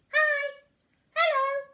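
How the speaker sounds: high, loud friendly greeting; the swan family greeting the duckling, high and cheerful. Acted. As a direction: chirp a bright welcome. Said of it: "they all said".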